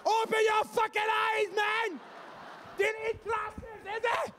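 furious fan bellowing at the ref; acted shouting. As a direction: yell at the referee from the stands. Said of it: "You have to do all the act".

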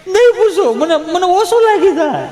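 mock tearful. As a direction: play the hurt man near tears, half laughing. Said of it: "ઓલો ગળગળો થઈ ગયો". (ગળગળા અવાજે રડમસ નકલ)

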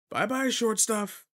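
high, loud, slow teasing sing-song; drawn-out teasing bye to the short kid; mocking farewell. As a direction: taunt them as you walk away.

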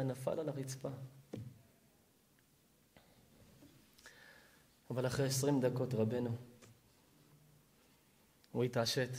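low, very soft, grave narration; solemn, subdued storyteller; hushed storytelling. Real, not acted. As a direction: tell the climax almost under your breath. Solemn hushed telling of the climax.

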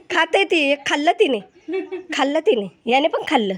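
loud, high, delighted exclaiming; excited. Real, not acted. Vlogger seeing the goats finally eat, excited.

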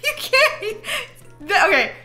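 high laughing protest; laughing, can't believe it; laughing disbelief. Real, not acted. Laughing disbelief about painted eggs.

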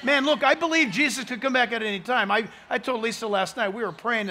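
ordinary talk

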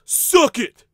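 fired-up taunt. Acted. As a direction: bark Suck it at the crowd. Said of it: "I'm still all fired up from the fight".

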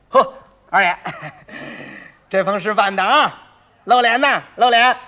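loud, hearty neighbour's praise; admiring congratulation. Acted. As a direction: gush praise at a respected neighbour. 街坊见面夸二爷丧事办得好. (大声恭维、夸赞)

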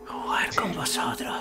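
creepy soft whisper; haunted whisper; possessed whisper. Acted. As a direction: whisper it like something inside him is speaking. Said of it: "Está poseído".

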